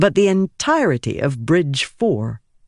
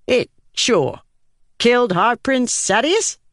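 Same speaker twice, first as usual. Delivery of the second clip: put-on higher Herdazian-accented questioning; 7 semitones higher than usual. Lopen asking Shallan at the pit, Herdazian accent. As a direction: ask it in a light Herdazian lilt.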